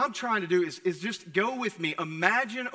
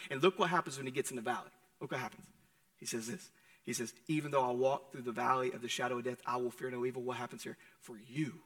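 hushed sermon voice; 6 semitones lower, 6 dB quieter than usual. Acted. Preacher drawing the congregation close, softly. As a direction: drop low and soft, almost confiding. Preacher drops to intimate hush.

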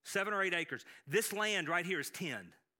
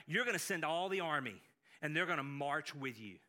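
ordinary delivery

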